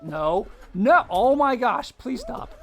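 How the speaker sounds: real loud dismay at the game; dismayed outcry. Real, not acted. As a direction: cry out as it goes wrong. Game going badly, real reaction.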